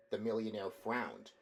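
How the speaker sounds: ordinary delivery, nothing marked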